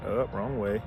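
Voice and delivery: ordinary delivery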